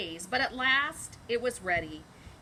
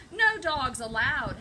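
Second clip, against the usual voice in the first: yelled; 3 semitones higher, 7 dB louder than usual. Acted. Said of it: "Hank yelled".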